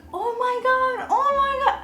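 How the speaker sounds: very high, thrilled "oh my God"; excited scream. Retold or imitated. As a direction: squeal with delight at the fish. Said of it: "I was screaming in excitement".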